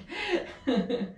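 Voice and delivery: short high laugh mid workout; breathy laugh. Real, not acted. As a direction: laugh out through the effort. Laughs during exercise.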